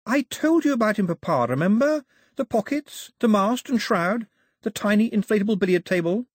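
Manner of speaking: put-on high, insistent girl's voice; excited daughter listing details; eager daughter. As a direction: remind Papa in a high, keen voice.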